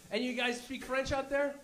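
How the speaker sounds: usual speaking voice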